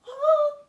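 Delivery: loud, high mock-horrified "oh"; alarmed gasp. Signalman spotting the oncoming train, acted alarm.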